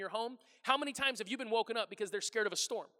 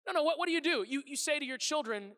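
normal talking voice

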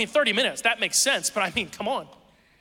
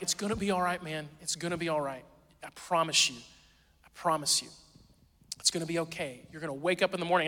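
soft, gentle reassurance to a scared son; reassuring dad; 2 semitones lower, 5 dB quieter than usual. Retold or imitated. Dad soothing his scared five-year-old at bedtime, soft and tender. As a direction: comfort him quietly, promising it'll be okay. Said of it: "I'm like".

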